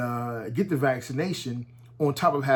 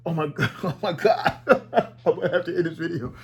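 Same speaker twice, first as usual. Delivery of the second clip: laughing through the words; can't stop laughing; real laughter; 2 semitones higher than usual. Real, not acted. Laughing at the stink of his own dog, real laughter.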